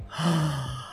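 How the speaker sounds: sharp mock gasp of delight; thrilled gasp. Reacting to a spot-on call-out, thrilled gasp. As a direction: suck in air, acting amazed.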